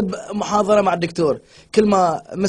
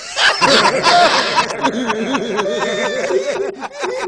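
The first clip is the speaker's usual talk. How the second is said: laughter; 3 semitones higher than usual. Real, not acted. Group laughing at joke.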